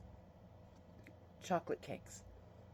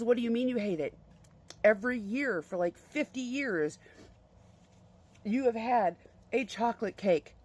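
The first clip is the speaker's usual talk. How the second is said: mildly raised, higher mock protest; retold dismay, punched word by word; devastated mom; 4 semitones higher, 5 dB louder than usual. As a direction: lightly imitate an upset wife challenging her husband. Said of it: "My mom was devastated".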